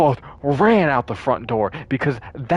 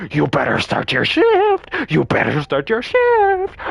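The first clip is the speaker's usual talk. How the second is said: demanding bark that ends much higher; nagging monster manager; 10 semitones higher, 3 dB louder than usual. Retold or imitated. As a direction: hammer the order at the fleeing workers. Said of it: "this manager who's constantly like".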